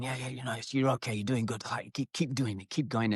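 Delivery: softly retold, secret encouragement; discreet encouragement. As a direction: urge him on quietly so nobody else notices. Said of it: "kept giving me little nudges".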